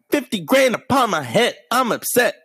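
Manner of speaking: high-pitched whiny rapper mimicry; mocking singsong rap delivery. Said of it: "When [name] was doing that".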